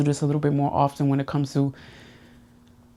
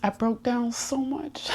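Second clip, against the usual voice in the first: soft and slow, close to tears, voice breaking; holding back real tears, slow and soft; tearful; 8 semitones higher, 7 dB quieter, slower than usual. Real, not acted. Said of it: "I'ma cry".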